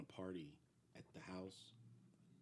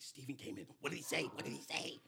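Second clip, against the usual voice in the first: panicked; 7 semitones higher, 6 dB louder than usual. Retold or imitated. Producers panicking, retold.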